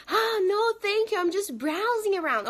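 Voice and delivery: high-pitched polite decline in English; polite customer. Acted. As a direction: act out a shopper brushing off the clerk. Acting out polite customer in store.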